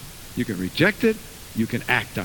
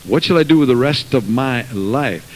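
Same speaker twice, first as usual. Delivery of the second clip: loud pulpit preaching; full-voiced sermon delivery; 3 semitones lower, 6 dB louder than usual. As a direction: drive it home to the pews. Preaching to congregation.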